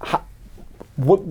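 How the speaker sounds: usual speaking voice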